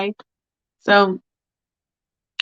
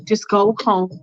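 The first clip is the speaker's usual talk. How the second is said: usual speaking voice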